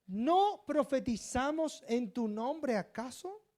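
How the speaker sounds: high, loud astonished appeal; great surprise. Acted. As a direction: plead in slow, stretched disbelief. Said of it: "me van a decir con gran sorpresa".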